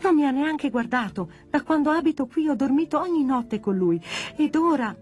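high-pitched, hurt lament; tearful outcry. Acted. Pino, ignored by the boy, laments to friends, crying out. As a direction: wail without shouting. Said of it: "urlando si gettò tra le braccia".

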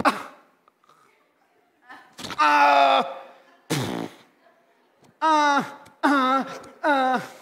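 high, loud whining cries; mock teen wail. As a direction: whine like a complaining teen. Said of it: "They expect to hear things like".

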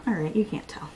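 voiceless aside; whisper. Real, not acted. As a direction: whisper it under your breath. Whispers while testing shimmer.

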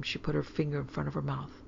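ordinary delivery, nothing marked

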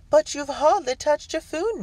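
high, loud polite protest; objecting hostess. A narrator's reading. Hostess stops twins leaving the table, high-pitched objection. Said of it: "Mistress Hewson objected".

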